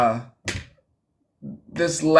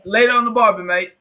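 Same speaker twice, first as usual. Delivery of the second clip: quick, higher, louder Aussie sign-off; joking Aussie send-off; mock Australian accent; 7 semitones higher, 5 dB louder, faster than usual. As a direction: toss off a fake Australian farewell. Host mimics Aussie sign-off.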